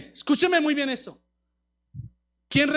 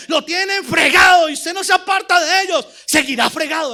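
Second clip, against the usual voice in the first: loud, driving sermon delivery; preaching at full force; 2 semitones higher, 7 dB louder than usual. Real, not acted. Preacher rebuking the paralytic's passivity, full-force preaching. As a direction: hammer the key words, preacher-style.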